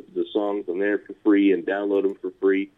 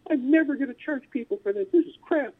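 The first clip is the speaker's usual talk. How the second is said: put-on high, indignant teenage vow; much higher kid voice swearing never to charge; young self vowing. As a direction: play your broke younger self swearing an oath. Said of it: "So I was like".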